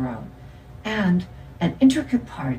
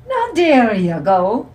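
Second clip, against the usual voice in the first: slow, high, loud mock-Irish lilt; dad hamming up an Irish accent; put-on Irish accent; 7 semitones higher, 6 dB louder, slower than usual. A narrator's reading. Dad delighted at his own joke, in a put-on Irish accent. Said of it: "in his put-on Irish accent".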